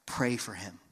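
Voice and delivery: low, soft, simple instruction; gently voiced divine nudge; voice of the Lord. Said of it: "this voice of the Lord saying".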